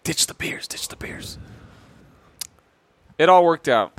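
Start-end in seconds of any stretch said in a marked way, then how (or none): 0.0-1.4 imitated furtive whisper; urgent whisper. Friend warning the others holding Heinekens, retold in a whisper.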